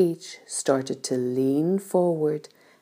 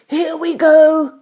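high, loud, drawn-out cheer; excited shout; 14 semitones higher, 8 dB louder, slower than usual. A narrator's reading. Old grasshopper hopping with excitement, excited shout. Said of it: "shouted the old green grasshopper".

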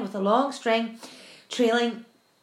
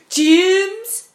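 higher, louder bawled name, rising; 6 semitones higher, 9 dB louder than usual. Acted. Centipede bawling to James for help, loud. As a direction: bawl the name out. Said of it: "bawled the centipede".

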